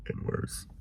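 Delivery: slow ominous whisper of worse and worse. Real, not acted. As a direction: let it sink in, hushed and slow. Repeats line as ominous whisper.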